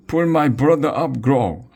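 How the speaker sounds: high, loud urgent shout. Acted. Dudwin shouts at Grog to pull his brother up. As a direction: yell up at Grog to save your brother. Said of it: "Dudwin shouting".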